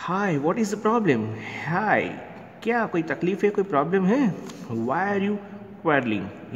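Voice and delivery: Tittu the monkey. Acted.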